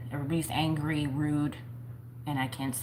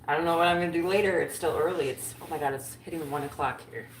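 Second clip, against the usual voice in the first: ordinary talk, nothing marked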